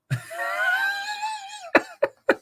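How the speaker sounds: shrieking high laughter; howling laugh. Real, not acted. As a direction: double over laughing. Howling at the memory.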